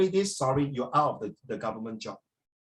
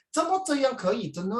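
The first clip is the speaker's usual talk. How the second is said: high-pitched Taiwanese Mandarin; Taiwanese accent; 7 semitones higher than usual. Acted. Someone overheard in Taiwan questioning, high Taiwanese Mandarin accent. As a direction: mimic a lilting Taiwanese questioning tune. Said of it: "你去台湾".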